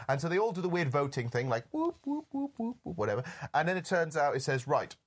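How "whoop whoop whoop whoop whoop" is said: high playful whoop noises; mock whoops. Describing the immortals' vote, playful high whoops. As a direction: chirp them out like a strange ritual. Imitating weird voting noises.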